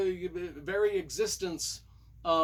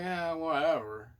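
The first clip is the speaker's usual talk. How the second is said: dismissive shrug; slower than usual. Pretend. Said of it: "Sigma Force is kinda like".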